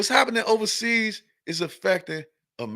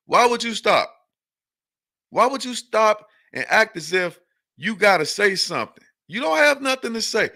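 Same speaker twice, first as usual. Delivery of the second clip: heated retort; 6 semitones higher, 4 dB louder than usual. Retold or imitated. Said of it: "I'm like".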